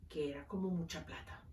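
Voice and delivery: whispered side comment to the congregation; whispered aside. Real, not acted. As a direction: drop to a whisper for a quick aside. Whispered aside during reading.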